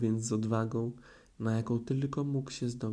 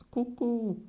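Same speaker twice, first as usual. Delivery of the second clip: very high, squeaky little piglet call; piglet's loudest peekaboo; 12 semitones higher than usual. A narrator's reading. Piglet pops out of the pouch, very high squeak. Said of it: "jak tylko potrafił najgłośniej".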